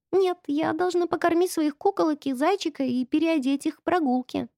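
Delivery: put-on high, good-girl child voice; model-girl voice. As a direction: play the obedient little girl. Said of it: "примерным голоском".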